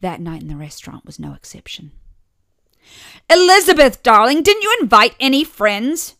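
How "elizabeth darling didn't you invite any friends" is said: high, loud, gushing mother voice. A narrator's reading. Mother calling to her daughter across the restaurant table, loud and high.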